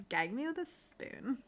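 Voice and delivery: mock-disgusted Valley Girl; Valley Girl accent. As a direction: put on a fake Valley Girl whine. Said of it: "used to do the Valley Girl accent".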